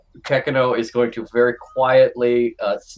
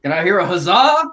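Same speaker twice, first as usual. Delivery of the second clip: higher, louder call for a cheer; rallying call; 7 semitones higher, 5 dB louder, faster than usual. Acted. Slug villager celebrating victory asks for a cheer, rousing call. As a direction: rouse the crowd, rising at the end.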